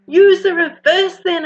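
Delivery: loud, high, urgent shout. Acted. Ophelia shouting to Albert over the roaring vacuum, loud. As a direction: bellow the order over the racket. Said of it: "Ophelia shouts".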